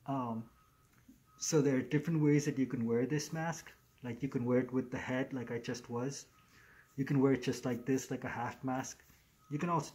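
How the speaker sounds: muffled behind fleece mask; through a face mask. Real, not acted. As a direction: mouth covered by fabric.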